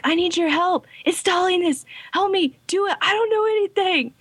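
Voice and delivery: high, pleading little brother; high pleading kid voice. Retold or imitated. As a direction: beg for help in a high voice. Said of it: "And he's like".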